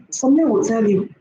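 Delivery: ordinary talk, nothing marked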